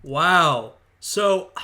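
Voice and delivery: usual speaking voice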